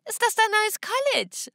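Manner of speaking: high, slow, teasing little-sister voice; bratty younger sister voice. Acted. Little sister mocks Olivia from the garden, high and slow teasing. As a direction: sing it up at her like a mocking kid. Emily teases sister at window.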